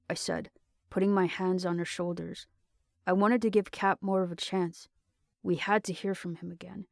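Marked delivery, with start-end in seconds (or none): none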